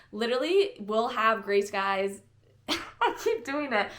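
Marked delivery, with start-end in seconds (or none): 2.4-3.9 real laugh, slow and higher pitched. Real, not acted. Vlogger amused at repeating their own slip, laughing. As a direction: laugh helplessly through the words.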